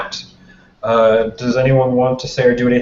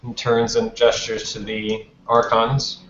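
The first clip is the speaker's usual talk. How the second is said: usual speaking voice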